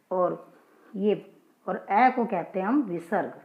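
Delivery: normal talking voice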